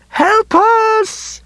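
high screamed plea for help; desperate scream. A narrator's reading. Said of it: "a voice screamed through the broken window".

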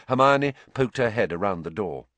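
usual speaking voice

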